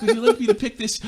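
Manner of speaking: high-pitched, laughing overpoliteness; mock annoyingly polite. Acting out two friends in a coffee shop, annoyingly polite. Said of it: "almost annoyingly polite".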